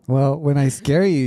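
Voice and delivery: normal talking voice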